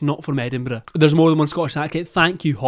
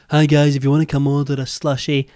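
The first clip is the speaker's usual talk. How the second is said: lower, louder mock vlogger greeting; impression of her former, deeper greeting; imitated older-video voice; 3 semitones lower, 5 dB louder than usual. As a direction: mimic her old voice, without the high pitch. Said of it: "she didn't speak that high pitched in her older videos".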